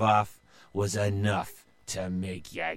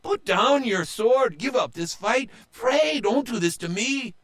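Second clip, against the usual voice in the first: much higher, pleading voice; pleading brother; 11 semitones higher than usual. A narrator's reading. Brother pleading with his pirate sister, much higher. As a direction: beg her to stop. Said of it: "said Joe".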